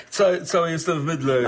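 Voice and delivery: sheepish chuckle while apologising; embarrassed laugh. Acted. Host covered in shaving soap greeting guest, laughing sheepishly. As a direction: laugh it off through the words.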